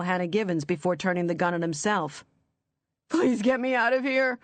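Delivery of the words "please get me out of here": higher, tearful begging; crying plea. Acted. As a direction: cry out to be freed. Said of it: "Walter cried".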